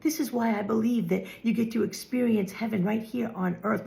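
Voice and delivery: usual speaking voice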